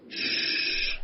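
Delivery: long, very soft shush; hushing shh. Acted. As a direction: hush her with a drawn-out hiss of air. Man hushes her.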